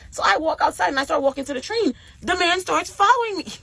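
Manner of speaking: high, loud, incredulous telling; worked-up disbelief. Real, not acted. Vlogger telling viewers the man followed her, worked-up disbelief. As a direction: exclaim it high and loud, scandalized. Alarmed climax of her story.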